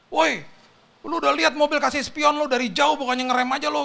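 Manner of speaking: high, loud telling-off; angry bystander scolding. Retold or imitated. Bystanders scolding the rider who crashed, angry yelling. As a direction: yell it like an outraged onlooker. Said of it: "orang-orang pada marah-marah sama dia".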